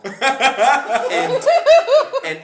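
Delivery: laughing. Real, not acted. Laughs again telling story.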